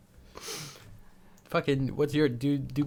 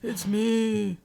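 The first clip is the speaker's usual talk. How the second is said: put-on very old man. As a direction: drawl it like a dying grandpa. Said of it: "fun to do an old man voice".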